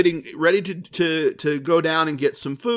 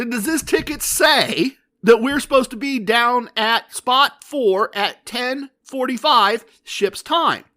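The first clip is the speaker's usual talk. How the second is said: higher, slow courtroom cross-examination; Cousin Vinny; 6 semitones higher, 4 dB louder, slower than usual. Retold or imitated. Grilling the guest services lady like a courtroom lawyer, slow and pointed. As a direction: pin her down word by word like a trial lawyer. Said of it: "I'm doing my best Cousin Vinny here".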